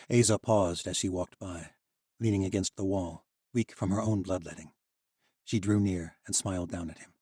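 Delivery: plain reading voice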